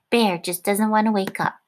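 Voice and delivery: ordinary delivery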